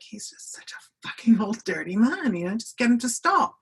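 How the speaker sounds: exasperated accusation, recalled with some heat; retold angry outburst. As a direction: re-enact blurting out a furious complaint. Retold angry outburst after storming out.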